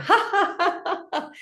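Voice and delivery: high popping ha-has; sudden high-pitched laughter; demonstrated laughter. Shown as a demonstration. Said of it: "you laugh with it".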